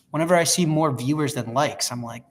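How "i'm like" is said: ordinary talk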